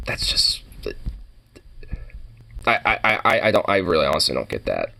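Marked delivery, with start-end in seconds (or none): 0.1-0.6 breathy whisper; whispered aside. Real, not acted. Baffled that every soda is Coke, whispered aside. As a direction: drop to a bemused whisper.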